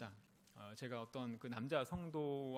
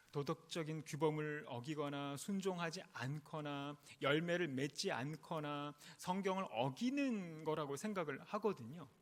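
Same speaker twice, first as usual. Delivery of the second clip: ordinary delivery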